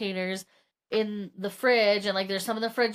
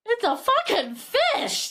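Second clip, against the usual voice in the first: high, drawn-out disbelieving cry; a hammed-up fed-up husband; exasperated outburst; 11 semitones higher than usual. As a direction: blurt it out in total exasperation.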